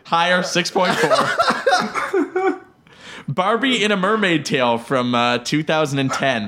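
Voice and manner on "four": laughing. Real, not acted. Laughing at wrong guess.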